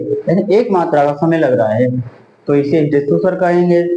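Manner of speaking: ordinary delivery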